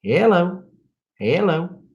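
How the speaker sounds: high-pitched Southern "hello"; exaggerated Southern accent. Shown as a demonstration. Showing he's not quite that Southern, mock Southern accent. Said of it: "I'm not quite that Southern".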